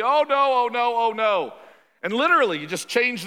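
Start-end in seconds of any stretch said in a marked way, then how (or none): 0.0-1.5 louder and higher mock wail of dismay, fading; the other half reacting to the loss, hammed-up dismay. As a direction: imitate voters groaning over a lost election. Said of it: "and the other half would be".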